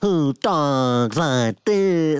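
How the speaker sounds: over-the-top, dragging every word; Captain Kirk impression. Mocking the over-the-top galaxy hero, Captain Kirk impression. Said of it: "that's how I do the impression".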